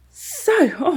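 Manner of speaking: high, loud, breathless gasp; out of breath. Real, not acted. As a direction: catch your breath mid-word. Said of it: "I'm getting out of breath".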